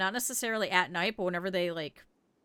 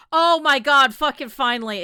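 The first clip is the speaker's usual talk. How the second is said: much louder, exasperated relief; relieved outburst; 4 semitones higher, 11 dB louder than usual. Real, not acted. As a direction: burst out with relief. Trader finally arrives.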